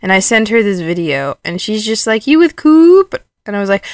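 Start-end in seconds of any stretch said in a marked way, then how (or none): 2.3-3.1 loud, high-pitched quoted question; high texted question. Retold or imitated. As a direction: raise the pitch and volume, acting out a text. Reading out mom's texted question.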